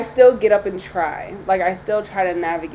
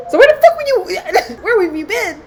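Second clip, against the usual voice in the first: much higher, louder, half-laughing disbelief; exasperated mock outburst; 9 semitones higher, 6 dB louder than usual. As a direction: play the frustrated complaint half-laughing. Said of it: "And I'm like".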